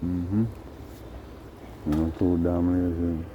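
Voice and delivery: ordinary talk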